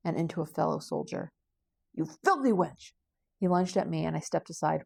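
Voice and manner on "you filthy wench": loud, drawn-out snarl, dropping in pitch; enraged soldier's shout; angry insult. Acted. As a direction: hurl the insult slowly and loudly. Soldier insults his attacker.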